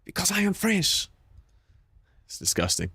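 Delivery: high-pitched put-on French accent; mock French accent. Streamer playfully declaring himself French to chat, mock French accent.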